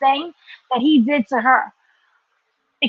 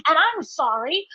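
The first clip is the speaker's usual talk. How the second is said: normal talking voice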